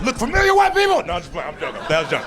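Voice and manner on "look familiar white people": very high, strained comic outburst; high mock cry. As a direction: squeal it at the audience as a bit. Sudden acted bit, a joke.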